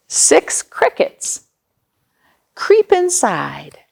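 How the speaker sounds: slow, rhythmic picture-book reading; reading to children. Acted. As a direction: read each counting line slowly, savouring it.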